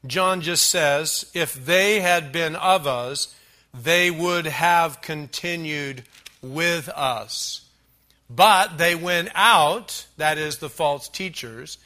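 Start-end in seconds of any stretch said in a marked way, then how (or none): 1.3-10.1 slow, weighty Bible reading, loud at the peaks; emphatic scripture reading. Acted. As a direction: spell out the verse slowly from the pulpit. Reads the verse aloud.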